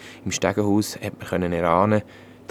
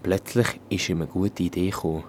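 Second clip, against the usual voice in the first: usual speaking voice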